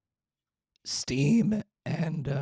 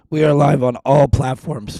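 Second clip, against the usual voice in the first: much louder, calling out to the viewers; much louder livestream call to all platforms; loud announcement; 13 dB louder than usual. Real, not acted. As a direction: announce it loudly to everyone.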